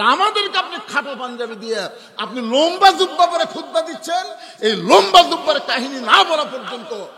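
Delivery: loud, high-pitched challenge to the caliph; acted confrontation. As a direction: interrupt the sermon with a forceful public objection. Companion confronts Omar in story.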